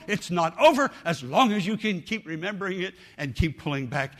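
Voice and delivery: urgent pleading preacher; pleading preacher altar call. Acted. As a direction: plead with them to come forward.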